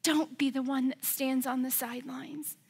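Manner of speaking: ordinary talk, nothing marked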